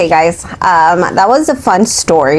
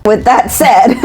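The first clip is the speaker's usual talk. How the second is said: higher-pitched, breaking into laughter; laughing; 7 semitones higher than usual. Real, not acted. Host signing off to listeners, laughing through it. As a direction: start the sign-off while cracking up. Cracks up starting the sign-off.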